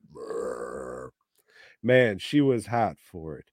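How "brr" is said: deep, quiet growling rumble; low guttural growl. Acted. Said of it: "a low guttural growl".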